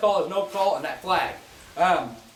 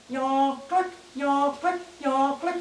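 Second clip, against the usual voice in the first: "yaw, click" spoken higher, like a goose call; voicing the call; 7 semitones higher, much slower than usual. Shown as a demonstration. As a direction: sing out "yaw" then snap "click", higher pitch. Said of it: "all I'm doing on the cluck moan".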